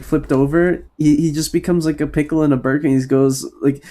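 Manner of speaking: ordinary talk, nothing marked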